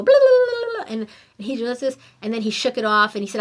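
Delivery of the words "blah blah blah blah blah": silly blah reset. Shown as a demonstration. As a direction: shake it off with a goofy high babble. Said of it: "and we go".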